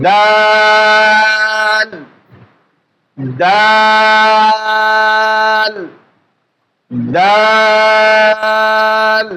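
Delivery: দাল held very long and slow, tongue tip on upper teeth; drawn-out Arabic dal. Shown as a demonstration. Teacher demonstrating the letter to students, slow drawn-out দাল. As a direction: stretch দাল out slowly and steadily. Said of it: "দেখেন".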